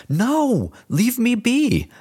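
high desperate plea, drawn out; pleading boy. Acted. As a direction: beg to be let go as you're dragged. Boy dragged to window.

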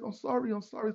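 high, repeated sorry; lightly voiced apologetic stranger; imitated apologetic driver. Retold apology of the truck driver.

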